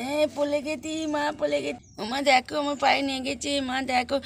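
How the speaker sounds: put-on high-pitched toddler complaint; little one reporting a hurt; hurt little child. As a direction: play a small child pleading for mother's attention. Fallen child complains to mother.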